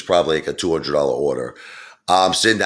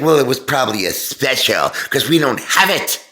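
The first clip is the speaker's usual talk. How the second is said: higher, put-on dismissive waitress voice; snooty waitress; 8 semitones higher than usual. Waitress claims it was a special, lightly mocked snooty voice. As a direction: brush the customer off, mock-haughty. Rude waitress denying a dish.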